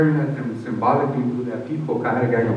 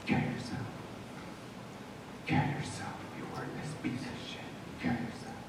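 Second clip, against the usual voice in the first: soft unvoiced whisper, coaxing; tempting inner voice; 20 dB quieter than usual. Man reenacting the voice urging suicide, coaxing whisper. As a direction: whisper it like a seductive voice in his head. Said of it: "an attractive voice comes".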